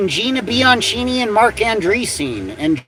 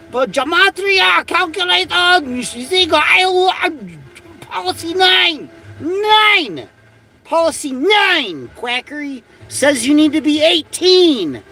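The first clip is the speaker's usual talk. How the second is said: mocking voice; 8 semitones higher than usual. Mocking the website's age policy.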